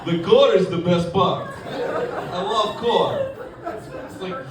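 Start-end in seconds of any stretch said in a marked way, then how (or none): 0.0-3.3 put-on savoring Russian accent, drawn out; emphatic Russian-accented praise; Russian coworker. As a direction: relish it in a slow Russian accent. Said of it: "I work with a guy from Russia".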